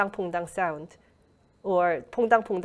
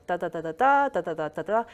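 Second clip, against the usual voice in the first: wordless "da, da, da" syllables tracing a sentence's rise and fall; rhythm demo; faster than usual. Shown as a demonstration. Learner shadowing native Korean speakers by ear, rhythm demo. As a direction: chant "da, da, da" as bare speech rhythm. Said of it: "listen to the rhythm".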